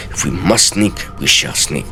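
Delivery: ordinary delivery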